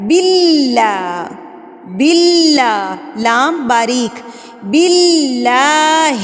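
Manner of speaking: باللہ slow, the doubled لام pressed firmly and held; doubled lam stressed. Shown as a demonstration. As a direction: lean hard on the tashdid in باللہ. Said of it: "مضبوطی سے ادا کرنا ہے". (باللہ میں تشدید مضبوطی سے)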